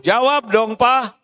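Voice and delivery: wife urging husband to reply; demanding wife. Retold or imitated. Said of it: "kalau disuruh".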